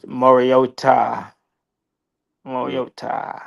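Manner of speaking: "Mariota" drawn out slowly, ending on a long "tah"; echoed pronunciation. Retold or imitated. Trying out co-host's way of saying the name, "Mariota" with long "tah". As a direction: repeat "Mariota" slowly, stretching the final "tah". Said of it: "Oh, you said Mariota".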